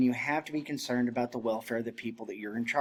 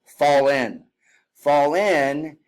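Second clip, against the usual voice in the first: loud, all at once; drill command; 2 semitones higher, 7 dB louder, much slower than usual. Shown as a demonstration. Instructor calling the unit to fall in. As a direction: shout it as one command. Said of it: "an example like fall in".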